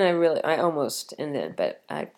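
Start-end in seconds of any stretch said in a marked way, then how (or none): 1.2-2.1 hushed calm. Retold or imitated. Recalling staying calm on plane.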